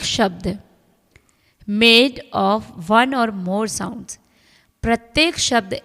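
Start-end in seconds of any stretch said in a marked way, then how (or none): 1.7-4.2 reading the rule aloud very slowly. Acted. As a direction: dictate each word slowly to the class. Reads English text slowly. (नियम को बहुत धीरे-धीरे पढ़कर सुनाना)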